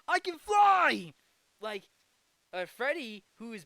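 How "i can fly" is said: high, slow, elated shout; excited shout. Retold or imitated. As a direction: yell it like you just took off. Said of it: "he was like".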